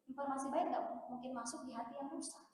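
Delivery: ordinary delivery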